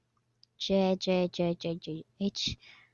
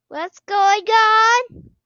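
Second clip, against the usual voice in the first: higher, louder, slow rising what's going on; scared drawn-out question; 6 semitones higher, 5 dB louder, much slower than usual. Real, not acted. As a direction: stretch it out in fear.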